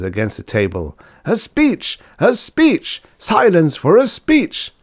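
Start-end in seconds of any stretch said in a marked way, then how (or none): 1.2-4.7 very high, rowdy calls; roaring students. A narrator's reading. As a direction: clamour for the toast like a student crowd. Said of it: "roared the students, hammering their beer glasses".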